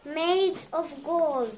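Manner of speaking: normal talking voice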